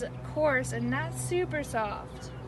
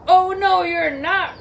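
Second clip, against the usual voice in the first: high, loud troll refusal; booming storybook troll; troll's roar; 5 semitones higher, 13 dB louder, slower than usual. Acted. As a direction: roar it out in outrage. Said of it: "roared the troll".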